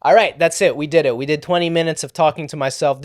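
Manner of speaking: ordinary delivery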